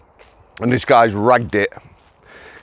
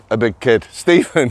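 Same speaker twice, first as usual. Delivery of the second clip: ordinary talk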